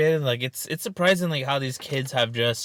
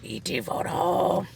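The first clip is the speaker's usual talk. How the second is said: slow alien creature impression; 6 dB quieter, slower than usual. Joining in on telemarketer's character list, slow alien impression. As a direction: draw out the last words like a movie alien. Mimicking E.T. among listed characters.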